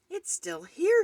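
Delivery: high, loud outraged exclamation; furious cry. A narrator's reading. Frizzle storms down and finds the baby ghost, furious cry. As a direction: cry out in fury. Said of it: "he cried".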